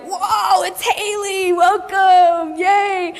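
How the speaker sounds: high, cheering welcome; high, delighted cheering welcome; excited welcome. Retold or imitated. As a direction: greet her like a party guest. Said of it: "we're like".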